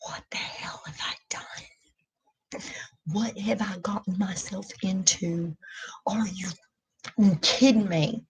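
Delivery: hushed, breathless whisper of her own panic; panicked inner whisper. Retold or imitated. To herself, about starting school, a frightened whisper. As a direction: whisper it like a private moment of dread. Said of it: "and now I'm just like".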